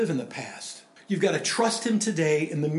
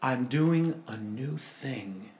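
solemn voice of God; 4 semitones lower, 4 dB quieter, much slower than usual. Acted. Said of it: "God says".

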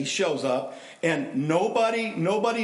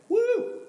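whooping woo; 3 dB louder than usual. Real, not acted. Exclaims about offense.

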